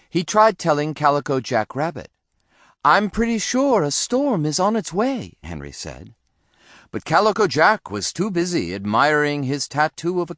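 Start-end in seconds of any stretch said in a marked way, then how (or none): 2.8-5.3 put-on high young bunny voice; earnest warning. A narrator's reading. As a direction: warn politely in a high little voice. Bunny son warns another pirate.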